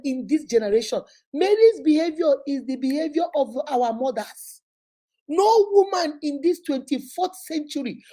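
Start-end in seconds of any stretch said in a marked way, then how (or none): none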